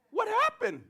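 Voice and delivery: high questioning demo. Shown as a demonstration. Preacher showing kids how to ask, high voice. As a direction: sing out what happened, high. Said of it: "Can you say that?".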